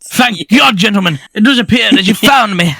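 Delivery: hammed-up relief at being found; Stewie impression. Acting tied-up Stewie being found.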